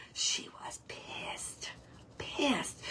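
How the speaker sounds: slow breathy-free hushed whisper. Real, not acted. Telling viewers the hen was angry, whispered. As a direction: drop to a slow whisper for effect. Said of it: "unvoiced whisper".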